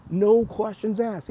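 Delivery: astonished emphasis; amazed. Real, not acted. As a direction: let the disbelief stretch the words. Amazed at the shop owner.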